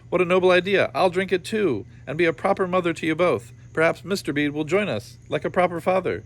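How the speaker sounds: high and loud, eager mother. Acted. Mother eagerly offering to drink the potion too, high and bright. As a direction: gush with noble enthusiasm.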